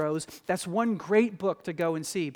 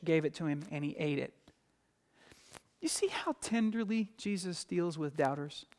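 usual speaking voice

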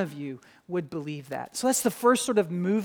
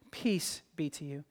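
soft, low near-whisper; hushed greeting; 5 semitones lower, 8 dB quieter than usual. Retold or imitated. Jesus appears among his talking disciples, in a hushed voice. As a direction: let the blessing drop into a gentle hush.

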